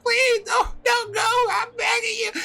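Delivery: high, loud wailing beg; mock-hysterical tearful begging; hammed-up crying plea. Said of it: "as she's crying and holding on".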